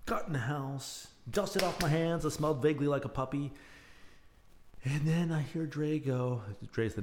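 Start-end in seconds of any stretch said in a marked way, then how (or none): none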